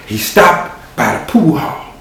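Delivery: usual speaking voice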